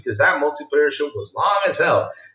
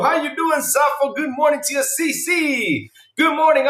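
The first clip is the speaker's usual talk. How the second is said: cheery greeting; 10 semitones higher than usual. Pretend. High greetings to viewers.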